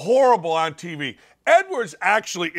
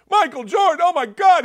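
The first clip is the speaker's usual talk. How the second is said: mock fan gushing; 10 semitones higher, 4 dB louder than usual. Mocking fans' adoration.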